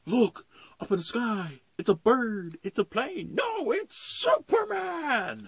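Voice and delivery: high, loud cartoon crowd cry; awed crowd shout. Everybody in Metropolis spotting the hero, high excited shouting. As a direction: call out skyward, stretching the final name.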